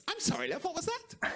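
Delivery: high-pitched little mum voice; shocked mum. Retold or imitated. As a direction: imitate a mother politely taken aback. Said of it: "this is how she reacted".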